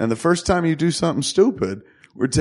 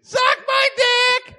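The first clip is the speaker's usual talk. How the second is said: slow, very high parrot screech; shrieking parrot catchphrase; awful parrot; much slower than usual. Acted. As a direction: squawk it drawn out like a parrot. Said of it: "he's like an awful parrot".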